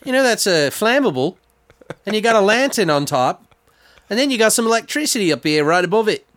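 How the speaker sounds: high mock voice of a know-it-all; quoting a guy. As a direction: play him fussy and concerned. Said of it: "he's like".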